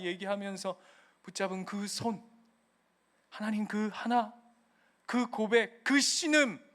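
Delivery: usual speaking voice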